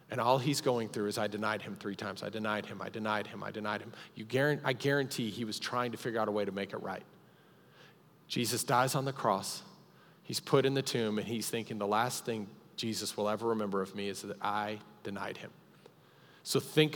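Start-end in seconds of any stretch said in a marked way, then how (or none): 1.2-3.9 quiet despair. Acted. Peter's guilt voiced softly. 11.8-15.5 quiet regret. Acted. Peter's inner thought voiced.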